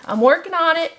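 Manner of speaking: I'm working on it lifted high, stress on on; playful insisting I'm working on it. Shown as a demonstration.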